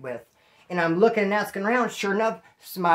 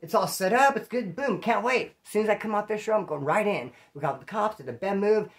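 usual speaking voice

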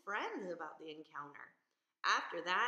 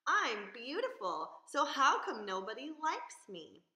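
whiny lonely fish; bitter complaint; 4 semitones higher, 7 dB louder than usual. Acted. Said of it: "he complained bitterly".

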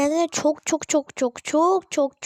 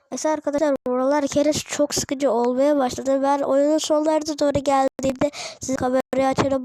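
ordinary delivery

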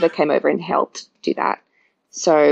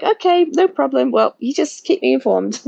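high, light and breezy reassurance; breezy chiropractor; 9 semitones higher than usual. Retold or imitated. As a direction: toss it off lightly, pitched up. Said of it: "She's like".

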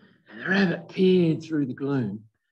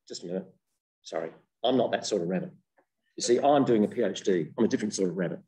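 lower, hurried earnest rabbit; a lower, quick, matter-of-fact rabbit; prim rabbit; 7 semitones lower, faster than usual. Acted. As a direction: brush the weasel off politely. Rabbit voiced in fable.

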